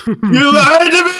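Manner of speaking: high, slow, strained cry of betrayal; Chris Farley meltdown. Retold or imitated. Coffee ad victim wails at the camera in a high, slow voice. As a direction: voice climbing high and slow as he's been betrayed. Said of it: "and he's like".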